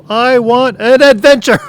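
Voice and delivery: full-volume, high-pitched imitation of her; retold yell. Husband imitates wife's last yell for adventure, all-out high yell. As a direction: yell it at the top of your range, as her. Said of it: "And then she yelled the last time".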